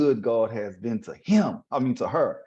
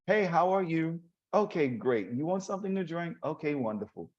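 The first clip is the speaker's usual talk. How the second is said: fake politeness; 5 semitones higher, 4 dB quieter than usual. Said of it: "If she don't like you".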